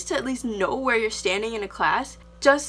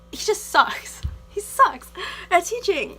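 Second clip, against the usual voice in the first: loud, pitched-up exasperation; frustrated outburst; 7 semitones higher, 3 dB louder, slower than usual. Real, not acted. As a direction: let it burst out, fed up. Frustrated rant about teacher.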